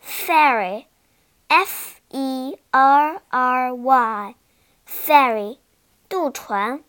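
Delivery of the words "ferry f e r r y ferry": slow letter-by-letter spelling. Shown as a demonstration. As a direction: spell each letter slowly for learners. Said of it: "第二个单词ferry".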